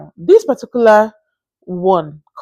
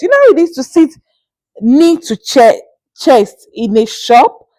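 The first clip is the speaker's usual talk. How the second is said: higher, louder, dragged-out disbelief; exasperated; 5 semitones higher, 5 dB louder than usual. Real, not acted. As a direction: stretch each word in outrage. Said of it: "exasperated retelling of lady's pose".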